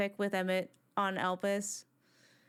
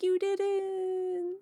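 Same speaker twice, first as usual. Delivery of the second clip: higher, lightly voiced gentle reply; 9 semitones higher than usual. Fan retelling the game scene, Mizo lightly voiced answering back. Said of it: "Mizo's just like".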